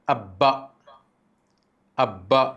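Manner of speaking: अब्बा, ब को दबाकर दोहरा; b held double in अब्बा; अब्बा with doubled b. Shown as a demonstration. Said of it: "ज़बर लाते हैं तो पढ़ेंगे अब्बा". (ब दोहरा करके अब्बा)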